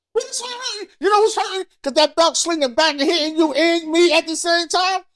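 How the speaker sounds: high-pitched, worked-up objection; indignant protest. Acted. Son disputing mom's whipping logic, high indignant protest. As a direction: argue back like a wronged kid. Argues against mother's saying.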